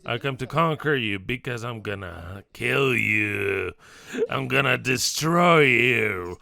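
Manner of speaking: a low, slow, menacing villain voice; villainous cell. Acted. Cancer cell threatening the lung, low menacing villain voice.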